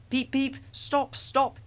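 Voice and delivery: high, slow whistle cries; whistling engine. A narrator's reading. Thomas dragged too fast behind Gordon, high whistled cries. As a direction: call out high, pleading to halt. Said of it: "he whistled".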